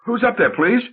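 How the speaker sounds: high, startled call into the dark; scared. Acted. Genevieve calls up to an unseen stranger, scared and high. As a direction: ask it frightened, voice jumping up. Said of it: "Genevieve, scared".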